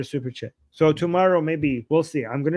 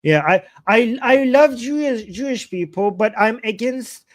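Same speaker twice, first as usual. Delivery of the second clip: mocking voice; 7 semitones higher, 4 dB louder than usual. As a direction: parody the hypocrite, pitched up. Mocking anti-Zionist hypocrite.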